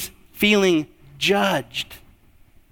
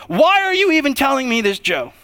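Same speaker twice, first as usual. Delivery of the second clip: exasperated listener; 7 semitones higher, 3 dB louder than usual. Retold or imitated. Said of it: "You're like".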